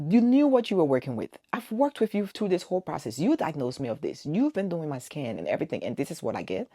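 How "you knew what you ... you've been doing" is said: higher and louder, accusing; angry accusation. Retold or imitated. Confronting the doctor over the cancelled surgery, angry and loud. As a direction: confront the doctor, voice raised high.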